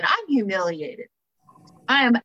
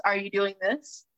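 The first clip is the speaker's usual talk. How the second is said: ordinary talk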